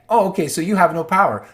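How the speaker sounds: loud, high, fast taunting "oh okay"; mock gloating. Taunting the powerless officials, loud and gloating. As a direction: rub it in with glee.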